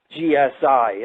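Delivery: ordinary delivery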